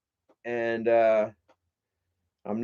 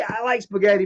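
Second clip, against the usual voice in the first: usual speaking voice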